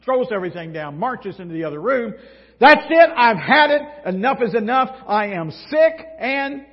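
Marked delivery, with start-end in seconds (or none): 2.6-6.6 much higher and louder furious outburst; explode, fed up and done; boiling over. Acted. Martha storming into the room at everyone, furious shout. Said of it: "reaches the boiling point".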